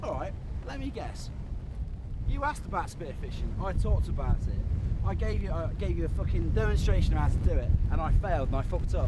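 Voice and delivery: loud, building bitter complaint; angry rant. Real, not acted. Ranting at crew about spearfishing.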